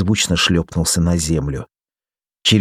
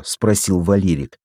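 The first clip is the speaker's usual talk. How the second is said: usual speaking voice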